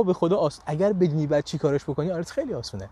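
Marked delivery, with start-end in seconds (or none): none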